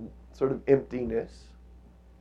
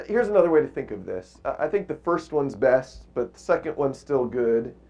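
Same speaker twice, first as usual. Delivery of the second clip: usual speaking voice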